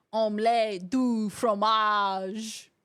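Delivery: slow, drawn-out cartoon French; Dexter's Lab French. Streamer quoting Dexter's Lab French to chat, slow cartoon French. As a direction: say it slowly like the cartoon. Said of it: "The only French I knew was from Dexter's Lab".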